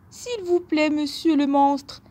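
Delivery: supplier d'une petite voix haute; voix aiguë de chevreau qui implore; petit bouc suppliant. A narrator's reading. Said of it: "supplia le bouc". (supplication aiguë du petit bouc)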